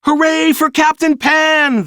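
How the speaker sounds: high, loud joyful cheer; children cheering. A narrator's reading. Children cheering Peter's victory, high and loud. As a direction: cheer like a crowd of kids. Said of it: "the children cheered".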